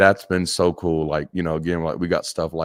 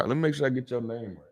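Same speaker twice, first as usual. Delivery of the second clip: ordinary delivery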